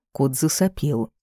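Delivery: usual speaking voice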